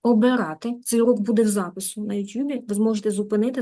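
usual speaking voice

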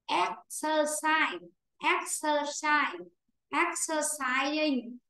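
drawn-out, evenly sounded syllables; slow model pronunciation. Shown as a demonstration. Teacher models the word for the class, slow and drawn out. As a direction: say it very slowly for learners.

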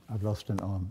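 low, subdued statement of loss; sober realisation. Retold or imitated. Man takes stock of his injured body, sober and low. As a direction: register the loss quietly. Sober discovery, then reframed.

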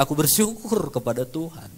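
ordinary talk, nothing marked

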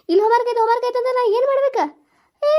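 ordinary talk

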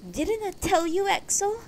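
high-pitched upset wife, mildly tearful; close to tears. A narrator's reading. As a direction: reproach him high, as if near tears. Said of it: "Beatrice sounded close to tears".

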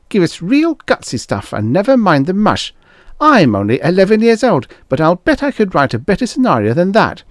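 put-on high, loud bratty kid voice; cheeky eleven-year-old. A narrator's reading. An eleven-year-old scolding the producer, bratty. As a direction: scold the producer like a know-it-all child.

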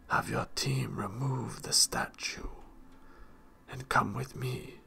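breathy voice of cold authority; commanding holy man. A narrator's reading. Shrive to the head maestrum of the mines, breathy command. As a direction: issue instructions in a breathy character voice. Shrive giving orders to overseer.

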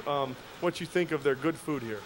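ordinary delivery

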